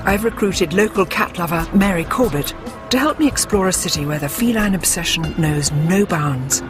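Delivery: usual speaking voice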